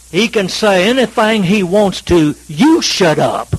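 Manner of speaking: loud, commanding Texas drawl. Retold or imitated. Huge security man glowers down at the objector, loud Texas drawl. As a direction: order him silent in a big Texas drawl. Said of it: "in his Texas drawl".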